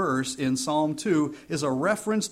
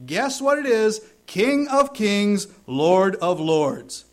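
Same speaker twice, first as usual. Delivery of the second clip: loud, slow, rising proclamation; proclaiming; 5 semitones higher, 5 dB louder, slower than usual. Real, not acted. Preacher reveals the name on Christ's thigh, loud and slow. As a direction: build to a triumphant declaration.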